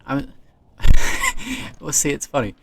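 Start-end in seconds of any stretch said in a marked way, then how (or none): 0.4-1.8 sudden high squealing laugh; a real laugh that shoots up high and loud; burst of laughter. Real, not acted. Laughing at his own take on Naked Attraction, a squealing laugh.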